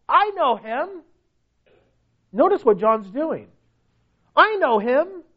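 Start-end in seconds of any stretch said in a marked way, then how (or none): 0.1-1.0 higher, lilting mock claim; lightly voiced professing believer. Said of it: "He who says". 4.4-5.2 higher, lilting mock claim; lightly voiced professing believer. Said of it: "He who says".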